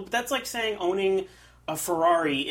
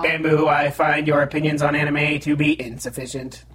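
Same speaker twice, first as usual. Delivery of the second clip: eighties robot voice effect; 9 dB louder than usual. Shown as a demonstration. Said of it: "Nineteen eighties robot voice".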